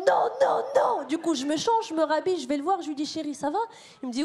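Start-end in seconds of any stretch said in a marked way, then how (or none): 0.1-1.0 mock shout. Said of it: "en criant". 3.1-3.6 soft concern. Retold or imitated. Checking on upset boyfriend.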